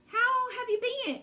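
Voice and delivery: high, eager "How have you been?"; bright greeting. Acted. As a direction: greet an old friend gladly. Pun on has-been greeting.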